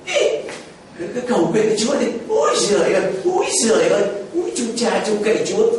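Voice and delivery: a sneering wife taunting her sick husband, with laughs; wife scoffing at her suffering husband's prayers, mocking voice. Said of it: "bà đi qua bên".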